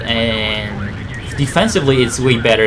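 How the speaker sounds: usual speaking voice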